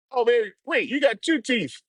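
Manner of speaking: high taunting put-on voice; taunting the local as he leaves; mocking reply. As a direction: egg him on in a high voice. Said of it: "I'm like".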